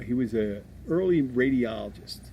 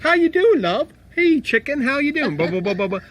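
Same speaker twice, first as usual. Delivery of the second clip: put-on loud, high British chimney-sweep accent; mock a cheery English greeting at full volume; hammed-up chimney-sweep English, pitched way up; [name]; 9 dB louder than usual. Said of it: "She sounds like a chimney sweep".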